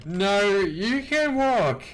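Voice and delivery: very high, loud, drawn-out wail; hammed-up panicked protest; loud mock refusal. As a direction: refuse in a stretched, high-pitched cry. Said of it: "I go".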